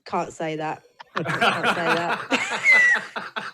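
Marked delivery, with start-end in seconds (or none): 1.2-3.5 put-on a slow, laughing stab at a Scottish accent; laughing Scottish accent try. Asked by the hosts for her best Scottish accent, slow and laughing Scottish. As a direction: drag it out in a giggly mock Scottish accent. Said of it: "let's have your best Scottish accent then".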